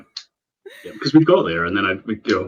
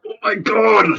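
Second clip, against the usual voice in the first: shocked exclamation; 12 semitones higher, 5 dB louder, slower than usual. Retold or imitated. Shocked exclamation at bins comment.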